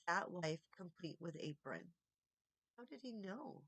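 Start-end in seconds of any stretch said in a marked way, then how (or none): 2.8-3.6 soft amazed murmur. A narrator's reading. Harvey alone with the gift, murmuring softly. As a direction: say it almost to yourself. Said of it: "Harvey murmured".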